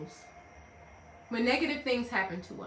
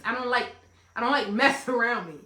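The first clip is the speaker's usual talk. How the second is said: normal talking voice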